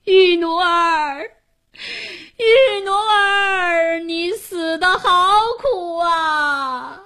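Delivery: high, very slow, drawn-out lament; wailing call. A narrator's reading. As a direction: cry out in grief for the lost daughter. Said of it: "临下船时呼喊着".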